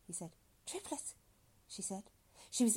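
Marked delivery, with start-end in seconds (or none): 0.7-1.2 high, soft echo of surprise; startled woman. Acted. Woman echoes doctor's birth news, softly startled. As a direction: repeat it lightly in a high woman's voice.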